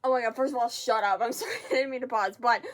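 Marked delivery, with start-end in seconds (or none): none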